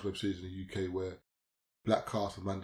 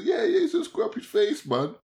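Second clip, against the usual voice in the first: much higher mock gossip voice; imitating schoolmates; 7 dB louder than usual. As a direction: play the old classmates eagerly agreeing. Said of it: "People say".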